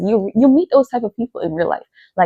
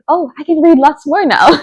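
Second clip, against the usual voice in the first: eager thought; 7 semitones higher, 5 dB louder, slower than usual. Retold or imitated. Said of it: "I just think".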